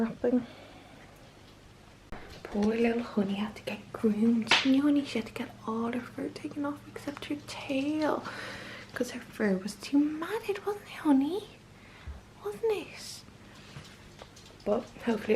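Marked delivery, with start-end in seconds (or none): 2.4-13.2 high whispered coo to a dog; soft sympathetic puppy talk, higher. Real, not acted. Owner cooing to shaved puppy Honey, high whispered baby talk.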